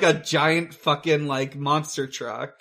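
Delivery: normal talking voice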